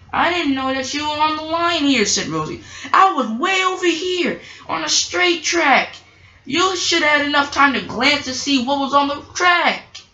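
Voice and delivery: lightly voiced Rosie, a touch defensive; Rosie's voice. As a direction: play Rosie explaining herself. Voicing Rosie.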